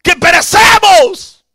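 high, loud, drawn-out cry; acted desperate yell; scolding shout. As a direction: yell it slowly in reproach. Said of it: "gritándole".

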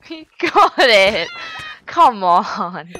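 loud, drawn-out cry of protest; frustrated shout. Real, not acted. Player protesting as opponent gets it, frustrated shout. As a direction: wail it out, stretching the words. Reacting to opponent's success in game.

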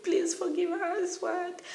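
high, slow frightened pleading. Retold or imitated. Scared girl pleading with the caning teachers. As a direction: beg the teachers to forgive.

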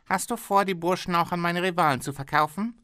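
a pushy boss bargaining hard; haggling factory owner. Acted.

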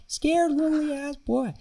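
high, drawn-out Boston accent impression; Boston-accented teacher stretching the words out high. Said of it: "He's from Boston. He's like".

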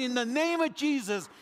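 loud, high cry of faith; fervent shout. Retold or imitated. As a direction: shout the name of Jesus over him. Convert shouting prayer, retold.

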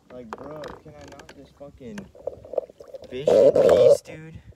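a sudden loud yell on fish in peace; fed up, blurt it out loud; exasperated outburst. Real, not acted. Frustrated at gators while fishing.

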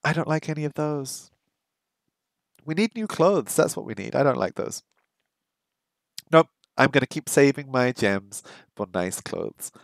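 ordinary talk

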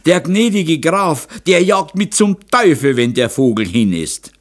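high, agitated complaint; loud lament. A narrator's reading. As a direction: wail in dread of the master. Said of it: "klagte Moser". (hohes, lautes Klagen)

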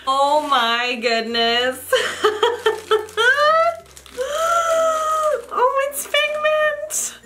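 very high, slow squeal; delighted squeal at the gift. Real, not acted. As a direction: melt over the ornament. Unwraps Figment ornament.

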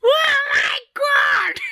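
very high, drawn-out squeal; mock amazement. Vlogger reacts to seeing Mala's face, hammed-up amazement. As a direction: gush in an exaggerated high shriek.

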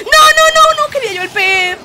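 high loud protest; frantic no; despairing shout. Real, not acted. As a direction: wail as it slips away. Losing the fish.

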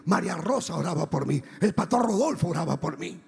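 ordinary talk, nothing marked

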